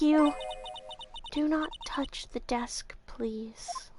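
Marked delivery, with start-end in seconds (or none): 1.3-3.9 slow, soft eerie whisper; a chilling hushed warning. Acted. Creepy receptionist warns.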